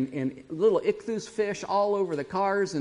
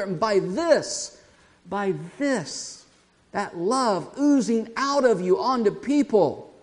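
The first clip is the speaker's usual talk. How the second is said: scripture recitation; 4 semitones higher, 4 dB louder than usual. Acted. Recites Jesus' words from scripture.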